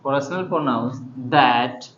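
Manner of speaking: slow, word by word dictation. Shown as a demonstration. Teacher dictating the definition slowly to students. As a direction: spell it out slowly for the class. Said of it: "teacher dictating slowly".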